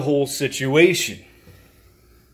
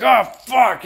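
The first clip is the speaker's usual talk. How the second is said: louder, higher acted curse; mock annoyance; 8 semitones higher, 7 dB louder than usual. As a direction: curse at the alarm. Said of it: "You're like".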